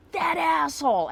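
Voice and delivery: high, loud re-enacted outburst; retold angry yell. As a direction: yell it out like losing your temper. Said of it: "I yelled out".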